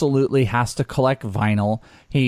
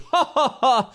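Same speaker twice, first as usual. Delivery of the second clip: belly laugh; 11 semitones higher than usual. Retold or imitated. Clown laughs holding his belly.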